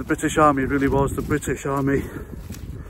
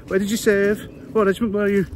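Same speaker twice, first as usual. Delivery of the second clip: higher mock-Scouse quizzing; put-on Scouse accent; 5 semitones higher, faster than usual. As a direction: keep pestering in a Scouse voice. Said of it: "I'm putting a Scouse accent".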